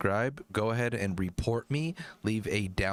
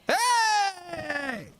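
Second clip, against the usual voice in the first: very high, stretched wailing yell; mock belting shout; 3 dB louder than usual. Parodying the voice coach's exercise, wailed shout. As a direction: overdo the belt, holding it long. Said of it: "A little bit lou".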